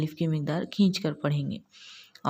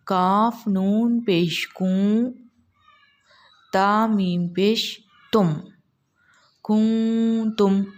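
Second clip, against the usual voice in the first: slow, drawn-out tajweed spelling; slow Quran recitation; 2 semitones higher, much slower than usual. Shown as a demonstration. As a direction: recite each letter slowly with the ikhfa. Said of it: "तो पढ़िए".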